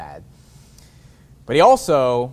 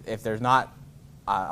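ordinary delivery

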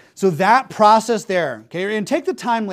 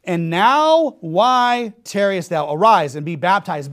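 slow, drawn-out reading of the verse; measured, weighty preaching read; emphatic scripture reading; 5 dB louder, slower than usual. Acted. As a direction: linger on now, then arise.

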